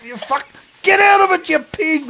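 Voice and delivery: very high mock shout of revulsion; disgusted yell. Mates recoil from the lad licking his leg, disgusted high yell.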